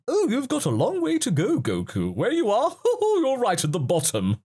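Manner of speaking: high, lilting mock voice with a chuckle; high, hammed-up airy teasing; Whis. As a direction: imitate an amused mentor teasing a pupil. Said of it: "as Whis said".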